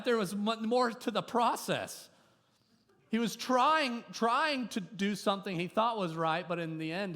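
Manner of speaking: high, loud preaching push; preaching the point of the story; fervent preaching. Real, not acted. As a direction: drive the lesson home. Preaching at full force.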